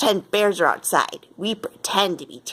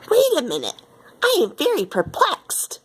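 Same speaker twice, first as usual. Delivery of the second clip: perplexed; 8 semitones higher than usual. Acted. Nancy confused by cowboy Eiffel.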